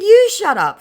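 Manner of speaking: high, loud acted comeback; retorting. Target answering the annoyed scammer, loud mock retort. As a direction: throw it back hard at the scammer.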